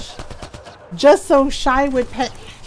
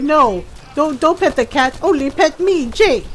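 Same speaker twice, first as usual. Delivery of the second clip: playful, pleading pet voice; lightly voiced demanding dog; jealous dog voice; 4 semitones higher, 3 dB louder than usual.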